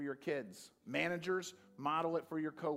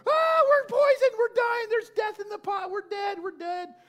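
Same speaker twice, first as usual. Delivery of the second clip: very high, loud panicked screams; panicked screaming; 13 dB louder than usual. Retold or imitated. Prophets at the poisoned pot screaming in panic. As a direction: scream like men dying of poison. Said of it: "They're screaming".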